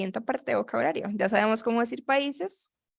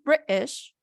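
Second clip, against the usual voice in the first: British in a posh British accent, higher; 4 semitones higher than usual. Shown as a demonstration. Teacher shows the student the British-accented British. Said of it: "con el acento British sería así".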